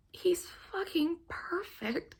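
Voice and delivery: breathy swooning whisper. Real, not acted. To viewers about Jamie, a swooning whisper. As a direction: whisper it like a secret crush. Gushing over a book boyfriend.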